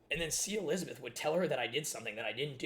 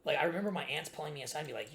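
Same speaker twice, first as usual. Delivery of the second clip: normal talking voice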